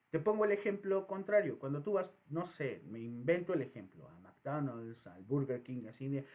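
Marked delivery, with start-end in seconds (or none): none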